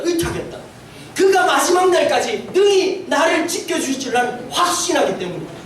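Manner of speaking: preacher reciting an apostle's letter; scripture recitation. Acted. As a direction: proclaim it like scripture read from the pulpit. Reciting Paul's words to Timothy.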